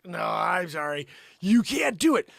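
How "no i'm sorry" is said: ordinary delivery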